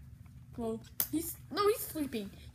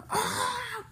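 high sharp yelp of pain; pained cry; 11 semitones higher, 5 dB louder than usual. Pretend. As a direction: cry out as if hit. Dragon hit in toy battle.

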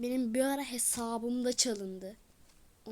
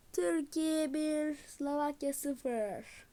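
commentator score call; 2 semitones higher, much slower than usual. Acted. Announcing the score after the goal.

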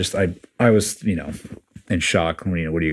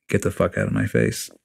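much lower, controlled put-down; retold firm dismissal; 8 semitones lower, 3 dB quieter than usual. Retelling his comeback to the chief, low dismissive delivery. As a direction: drop the pitch and dismiss him, no shouting.

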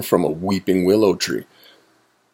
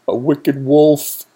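higher, slowed-down cry; mild alarmed cry; 7 semitones higher, 3 dB louder, slower than usual. A narrator's reading. Weasel spots a wolf, crying out. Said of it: "cried Weasel".

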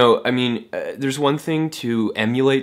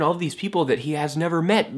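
normal talking voice

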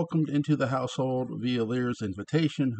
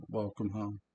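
softer, voiced near-whisper of defeat; 7 dB quieter than usual. A narrator's reading. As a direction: give in and barely say it. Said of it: "whispered, re-, repeated".

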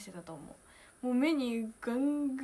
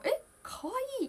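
very high "え、可愛い" squeal; startled fan squeal; 13 semitones higher than usual. Retold or imitated. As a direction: gasp it like seeing an idol. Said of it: "ってなる". (高い声で驚いて)

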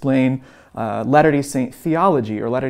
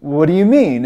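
mildly puzzled, slightly raised pitch; louder, mock-baffled question; 4 semitones higher, 6 dB louder than usual. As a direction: imitate a listener who is confused. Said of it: "a kind of a puzzled look".

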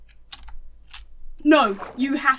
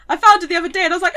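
ordinary talk

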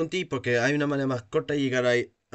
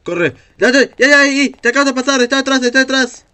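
high panicked shouting; being chased; 10 semitones higher, 10 dB louder, faster than usual. Real, not acted. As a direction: yell at them to run, now. Monster right behind teammate, urging.

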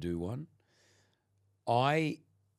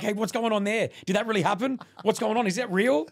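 high, loud excited questions; a hammed-up curious fan; mock texting friend; 10 semitones higher, 5 dB louder than usual. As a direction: play the nosy friend firing questions. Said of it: "Josh, he WhatsApps me like".

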